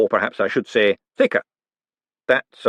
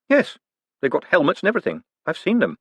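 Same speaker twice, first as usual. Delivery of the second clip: quick and eager; 6 semitones higher, faster than usual. Acted. Moist teasing about trolls.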